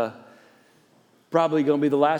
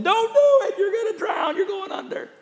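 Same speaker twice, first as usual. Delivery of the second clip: yelling disciples. Retold or imitated. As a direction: shout the warning from the boat, much higher. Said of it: "they're yelling at him and hollering".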